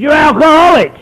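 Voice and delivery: loud, high, flat insistence; blunt old-timer. Retold or imitated. Old-timer insisting to the newcomer, loud and blunt. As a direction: hammer it home to his face. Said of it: "He said".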